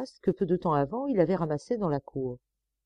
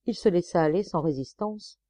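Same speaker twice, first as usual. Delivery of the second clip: ordinary delivery, nothing marked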